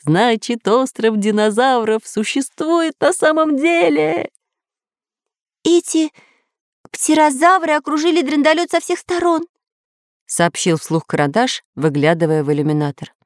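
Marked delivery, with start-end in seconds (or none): 0.0-4.3 high, ringing exultation; jubilant. A narrator's reading. Professor rejoices that the island exists, high and jubilant. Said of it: "ликовал профессор". 5.6-9.4 high-voiced alarm. A narrator's reading. Karandash reports the attack, high voice.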